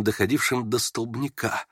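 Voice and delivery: ordinary delivery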